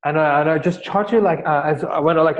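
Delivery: ordinary talk, nothing marked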